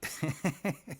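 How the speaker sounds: high, loud burst of laughter; delighted laugh. Real, not acted. Artist laughing at happy character, loud gleeful laugh. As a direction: laugh out loud with pure glee.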